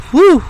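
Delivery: high, loud whew; whew, as if hit by a stink; mock stench reaction. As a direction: let out a big whoop of a whew. Reacts to smelly dog feet.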